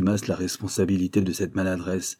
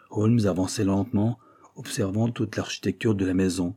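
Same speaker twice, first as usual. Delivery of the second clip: usual speaking voice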